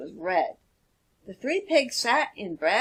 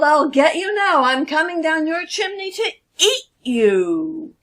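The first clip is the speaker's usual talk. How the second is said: much louder, higher snarling threat; growling wolf; 7 semitones higher, 7 dB louder than usual. Acted. Furious wolf growls threats at the pigs. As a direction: snarl it down the chimney. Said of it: "He growled".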